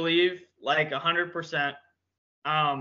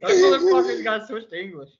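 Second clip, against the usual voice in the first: higher, laughing through the words; 4 semitones higher, 3 dB louder than usual. Real, not acted. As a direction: crack up while insisting. Joking as the group cracks up.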